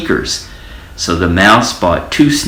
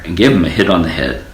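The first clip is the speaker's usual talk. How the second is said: plain reading voice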